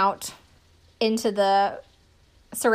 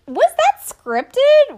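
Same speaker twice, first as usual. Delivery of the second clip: much higher, louder, very slow disbelief; incredulous; 10 dB louder, much slower than usual. Retold or imitated. Vlogger reacting to a staged-seeming scene, incredulous and loud. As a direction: stretch it out in shocked disbelief. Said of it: "I'm like".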